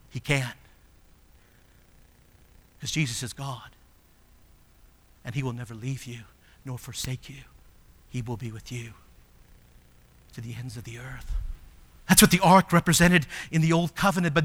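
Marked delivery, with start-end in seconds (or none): 0.1-11.3 much softer, lower, tender repetition; hushed reassurance. Acted. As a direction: bring it down, assure them quietly. Repeating the promise, hushed.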